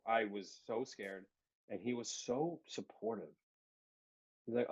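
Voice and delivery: usual speaking voice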